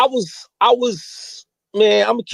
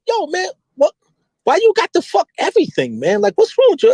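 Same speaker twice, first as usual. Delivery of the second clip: high, pressing exasperation; high retold disbelief; incredulous scolding; 9 semitones higher than usual. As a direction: demand answers from a reckless friend. Retelling scolding Poe.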